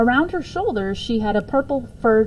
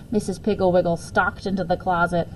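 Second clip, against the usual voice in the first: ordinary delivery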